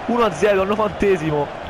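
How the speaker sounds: ordinary delivery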